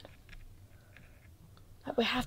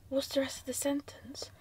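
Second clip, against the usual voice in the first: nervous night vlog whisper; 7 dB quieter than usual. Real, not acted. Whispering at the board.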